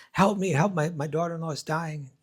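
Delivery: mock panic. Mother-in-law calls the snake doctor for help, mock panicked.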